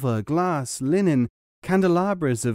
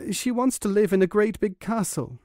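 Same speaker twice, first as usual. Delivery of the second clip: higher, nervous confession; a touch of fright, higher; half frightened; 8 semitones higher than usual. A narrator's reading. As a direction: blurt it out half afraid. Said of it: "half frightened".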